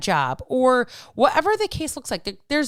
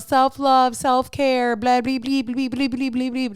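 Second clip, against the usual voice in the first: dismissive sing-song blah blah, higher; higher mocking singsong; 4 semitones higher than usual. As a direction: roll your eyes at the self-care buzz. Mocking self-love talk.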